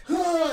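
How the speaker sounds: high, loud straining grunt; hammed-up effortful huff; mock strain grunt. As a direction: push out a huge effort noise. Wrestler straining at a dick flex.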